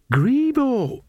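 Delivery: suddenly remember, with the pitch shooting up; high-pitched dawning worry; alarmed realisation. A narrator's reading. Nanny suddenly remembers her missing cat.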